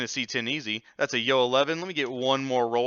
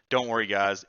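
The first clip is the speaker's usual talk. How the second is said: ordinary talk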